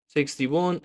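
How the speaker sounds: ordinary talk, nothing marked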